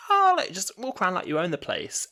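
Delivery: high, breezy dismissive imitation; David Tennant's Doctor. Retelling the Doctor's reply to Martha, high mock-breezy voice. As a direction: toss it off airily, pitched well up. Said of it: "David Tennant's Doctor just kind of was like".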